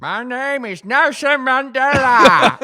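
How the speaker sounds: very high, loud, slow caricature; Nelson Mandela. Acted. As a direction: proclaim it in a hammed-up put-on voice. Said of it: "Do your Nelson".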